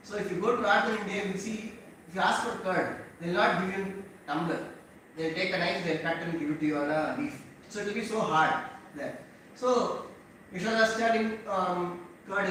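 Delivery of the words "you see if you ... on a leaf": ordinary delivery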